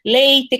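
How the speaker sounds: Leite with a crisp final "te"; Curitiba pronunciation. Shown as a demonstration. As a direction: say Leite ending in a clear "te". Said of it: "essas sílabas muito mais acentuadas".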